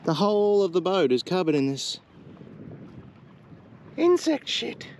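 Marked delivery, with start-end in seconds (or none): none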